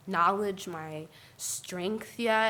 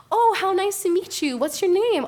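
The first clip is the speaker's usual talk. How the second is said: fake-sweet greeter; 9 semitones higher, 5 dB louder than usual. Microaggression voiced in mock tone.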